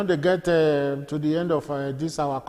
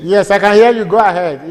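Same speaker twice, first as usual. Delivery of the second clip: loud, higher-pitched reassurance down the line; raised voice; 4 semitones higher, 13 dB louder than usual. Real, not acted. Host answering caller who asks "can you hear me", loudly. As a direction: call out loudly so the caller hears.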